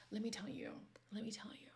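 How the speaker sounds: hushed, confiding whisper; breathy secret-sharing whisper. Real, not acted. Leaning in to share a secret.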